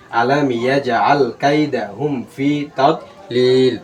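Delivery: very slow recitation, "তাদলিল" closed on L; joined-up recitation, stopped ending. Shown as a demonstration. Teacher repeating the joined verse for students, very slow stopped ending. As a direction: stretch each long syllable, close "তাদলিল" on lam. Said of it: "একসাথে।".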